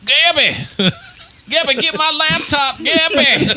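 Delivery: high loud mock yelling through laughter. Friend mimics Kelly yelling downstairs from the bathroom, high mock yell. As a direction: bellow the name up high, laughing.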